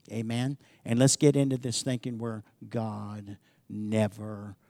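slow, low, soft preacher delivery; solemn hushed preaching. Real, not acted. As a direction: let each word sink in slowly and quietly.

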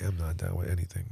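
fast breathy whisper; hurried whisper. Real, not acted. Refusing the hot chip.

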